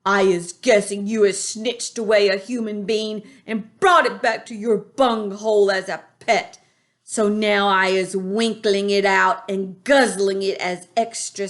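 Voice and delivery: loud, greedy menace; gloating giant. A narrator's reading. Bloodbottler gloats about eating the human. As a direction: relish the threat.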